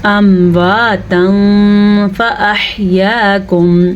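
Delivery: امواتا ending in a long concealed nasal hum; hidden tanween. Shown as a demonstration. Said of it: "چھپا کے پڑھا۔ کیسے؟".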